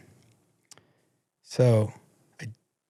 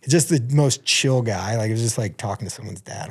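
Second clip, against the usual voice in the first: ordinary talk, nothing marked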